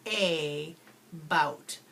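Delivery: A bout in two separate syllables, A long; syllables split apart. Shown as a demonstration. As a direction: model the split word for children. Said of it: "and you'll go".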